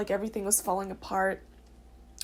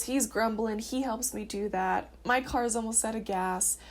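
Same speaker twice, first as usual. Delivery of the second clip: ordinary delivery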